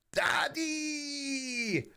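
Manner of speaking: high, drawn-out wail for Daddy; hammed-up kid's cry, long held and high-pitched; childlike calling out. Calls out to dad in jest.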